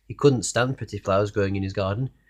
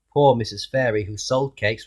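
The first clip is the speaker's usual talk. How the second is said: ordinary delivery, nothing marked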